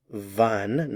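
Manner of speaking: wann said with a clear V sound, vann; German wann. Shown as a demonstration. Teacher shows learners German wann with a V sound. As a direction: model wann slowly, V not W. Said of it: "Notice the pronunciation".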